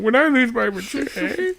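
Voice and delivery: high squeaky teen impression; mock teenager. Joking about own voice crack, mock teenager. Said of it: "Do I sound like a teenager talking about".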